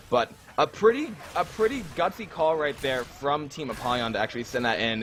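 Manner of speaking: ordinary talk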